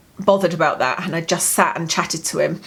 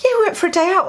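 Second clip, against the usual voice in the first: happy dad; 10 semitones higher, much faster than usual. Retold or imitated. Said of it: "he went".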